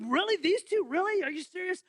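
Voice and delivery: high, incredulous voice; disbelief. Pretend. Host mock-shocked at the final quiz choices, high. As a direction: act shocked at the two options.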